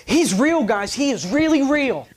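high, loud insistent pleading; urgent. Retold or imitated. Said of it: "he has this urgency in his voice".

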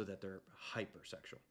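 whispered cheeky aside; whispering. Real, not acted. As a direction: drop to a whisper.

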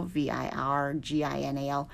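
"V I R G I N A L" said letter by letter, fast and soft; spelled out. Shown as a demonstration. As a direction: spell "V I R G I N A L" one letter at a time.

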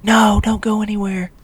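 high-pitched mock-girl plea; pleading drunk woman. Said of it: "And she's like".

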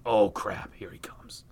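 low whispery aside, dropping away; hushed mock dread. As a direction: let it sink into a whisper as danger nears. Story narrator as car approaches.

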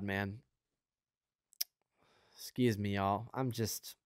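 quiet and choked with emotion; softly overcome, apologizing to the congregation; overwhelmed, choking up. Real, not acted. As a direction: let the feeling catch in your throat. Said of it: "God's just overwhelming".

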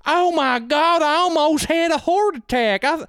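high, drawn-out imitated twang; mock Southern twang. Retelling an overheard Ohio woman, mock Southern twang. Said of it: "there was a woman who was straight up like".

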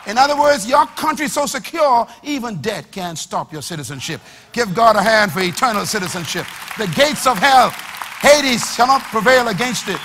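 loud fervent preaching. Acted. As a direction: preach it with full force. Preaching at full force.

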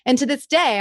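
ordinary delivery

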